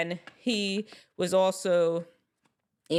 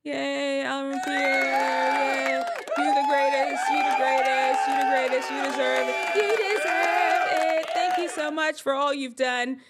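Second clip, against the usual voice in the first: mock cheering praise; 7 semitones higher than usual. Imitating fans cheering for the actor, excited. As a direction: cheer him on like an adoring fan. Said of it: "They were all singing his praises".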